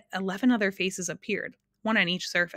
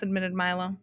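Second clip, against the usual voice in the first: ordinary delivery, nothing marked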